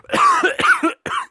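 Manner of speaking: loud, high choking cough fit; acted coughing. Choked on slurped water, acted coughing fit. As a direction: cough hard as if water went down wrong. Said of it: "that I coughed".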